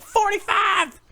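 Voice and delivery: high, loud mock auction yell; hammed-up bidder's shout, pitch leaping way up; shouted bid. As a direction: yell out an over-eager bid. Eager wife shouting an auction bid.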